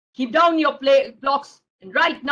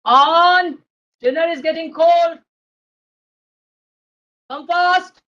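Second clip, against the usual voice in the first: loud, urgent dinner call; 4 semitones higher, 8 dB louder than usual. Acted. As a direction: grandpa hollering for a dawdling child. Grandpa calls again, dinner cooling.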